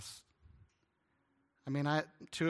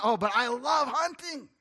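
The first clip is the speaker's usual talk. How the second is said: whiny insist; 8 semitones higher, 6 dB louder, slower than usual. Retold or imitated. Mock hunter insisting.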